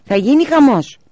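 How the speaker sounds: high, loud alarm; dramatic warning. A narrator's reading. Child warns dramatically. (δυνατή προειδοποίηση παιδιού)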